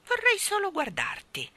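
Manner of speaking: high, calm cat voice. A narrator's reading. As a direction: answer coolly in a high-pitched cat voice. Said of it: "disse con calma il gatto". (voce di gatto, calma e acuta)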